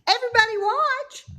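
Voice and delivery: high, loud eager cry; excited cry. Acted. Wilbur calling to the others to watch him. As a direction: shout it out full of hope. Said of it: "he cried".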